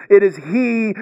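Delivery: he leaned on firmly; emphatic he, pointing to Jesus; stress on he. Shown as a demonstration. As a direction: land on the word he. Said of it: "notice the emphasis on the Greek word he".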